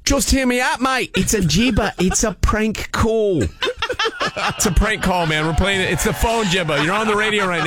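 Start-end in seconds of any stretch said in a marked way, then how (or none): 0.0-1.9 broad put-on Aussie accent; Australian accent. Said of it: "with this goofy accent". 2.0-3.4 drawn-out fake Aussie accent; slow reveal in a put-on Australian accent; broad mock-Australian, stretched out. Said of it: "My accent went over".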